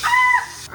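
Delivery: sudden high loud yelp; a sharp surprised squeal; startled shriek. Real, not acted. Startled in the kitchen, a loud high yelp.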